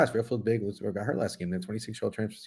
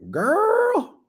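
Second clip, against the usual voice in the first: shocked squeal; 3 dB louder than usual. Real, not acted. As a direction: squeal it in disbelief. Shocked at point spread.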